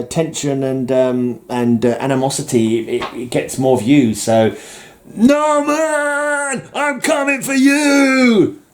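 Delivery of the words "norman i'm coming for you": very high, drawn-out mock battle cry; hammed-up threat. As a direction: holler a theatrical villain's challenge at a rival. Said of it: "I'm hamming it up for".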